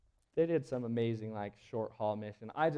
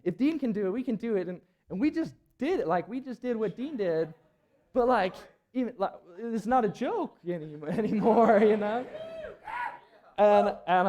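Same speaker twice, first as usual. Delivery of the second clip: excited; 9 semitones higher, 10 dB louder than usual. Real, not acted. Excited recap of climbing success.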